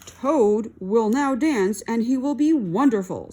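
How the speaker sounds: the strange voice presenting Toad's dance; announcer voice. A narrator's reading. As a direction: proclaim the dance grandly. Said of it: "said the voice".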